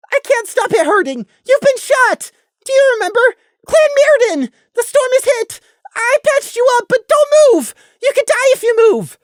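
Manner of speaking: very high, loud frantic yelling; very high, panicked shouting; desperate shout. Acted. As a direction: yell to keep a friend still. Prey yelling to wounded Gloom.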